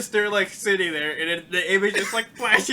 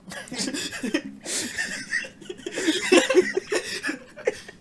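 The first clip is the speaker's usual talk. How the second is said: imitated stifled laugh; 14 semitones higher than usual. Retold classroom laugh.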